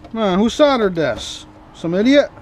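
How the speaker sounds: ordinary talk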